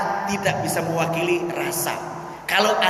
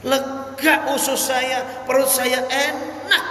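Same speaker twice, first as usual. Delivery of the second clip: a pleading beggar, pitched high and rising to a shout; beggar's voice; 7 semitones higher than usual. Acted. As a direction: raise the voice high and gush over the food. Beggar praising the one feeding him.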